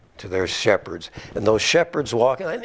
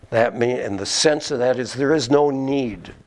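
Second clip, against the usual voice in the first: ordinary delivery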